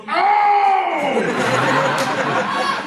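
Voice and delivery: a silly cry as he's slashed; mock yelp of pain. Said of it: "And they slash him and goes".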